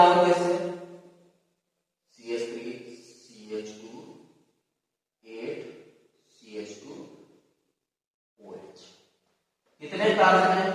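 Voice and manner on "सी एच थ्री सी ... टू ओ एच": low, soft spelling-out with long gaps; hushed, lower voice, piece by piece; writing aloud. Real, not acted. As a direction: murmur the formula as you write it.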